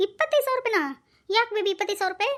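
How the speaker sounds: high, startled question; can't believe the sum; shocked at the amount. Acted. Husband told his wife wants money, high startled question.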